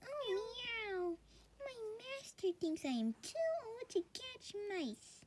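very high meowing cat voice. Acted. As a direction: speak as a sad old cat, very high. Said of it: "says the cat".

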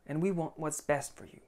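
mildly mock loving parent, falling at the end; passive-aggressive parent. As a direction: parent masking disapproval with soft words. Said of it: "It might be passive aggression".

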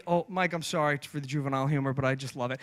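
ordinary talk, nothing marked

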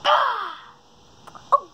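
ordinary talk

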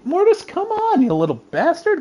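high scolding cat talk; exasperated high voice at the cat; scolding a pet. Real, not acted.

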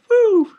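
loud, high-pitched woo; high whoop. Acted. Host cheering a goal to viewers, high whoop.

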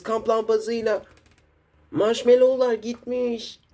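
put-on high, excited child hero; high kid hero voice. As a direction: voice young superheroes in a hurry. Kid hero characters talking.

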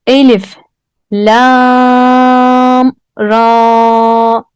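drawn-out Quranic letter recitation; reciting letter names. Shown as a demonstration. Teacher demonstrating letter names to students, recited slowly. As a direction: chant "Elif, lam, ra" slowly, holding "lam". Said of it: "Hadi gelin okuyalım birlikte".

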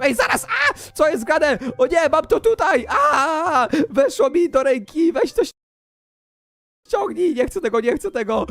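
high, yelping mock panic; playful panic. Player with a thing stuck in his hand, mock panic. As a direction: yelp in rising alarm, hammed up.

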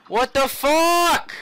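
high, slow outraged yell; panicking gamer. Real, not acted. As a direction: drag out the outrage.

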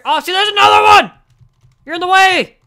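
exasperated outburst; frustrated yelling. Real, not acted. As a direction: shout in annoyance.

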